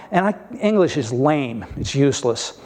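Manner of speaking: ordinary delivery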